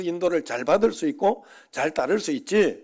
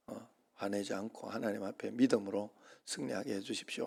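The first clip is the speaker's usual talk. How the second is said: hushed prayer; 5 semitones lower, 9 dB quieter than usual. Retold or imitated. Praying to calm his anger.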